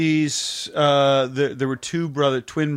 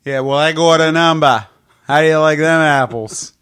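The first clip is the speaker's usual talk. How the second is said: louder, cocky Boston movie quote; hammed-up Boston, R's dropped; Boston accent; 7 dB louder than usual. Shown as a demonstration. Showing friends a Boston accent, loud and broad. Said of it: "can we hear your Boston".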